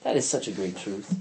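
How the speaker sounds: normal talking voice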